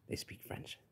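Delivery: breathy whisper, barely audible; hushed whisper under the breath; whispered aside. Acted. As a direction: whisper it like a secret. Aside about French speakers.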